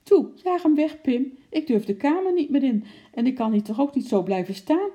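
loud, high frightened pleading; panicked wailing. A narrator's reading. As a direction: plead shrilly with your husband. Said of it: "jammerde Mien". (angstig jammerend en smekend)

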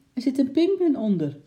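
high, loud cry of alarm; calling out. A narrator's reading. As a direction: shout a warning to the policeman. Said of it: "riep de meneer".

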